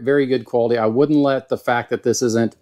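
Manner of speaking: normal talking voice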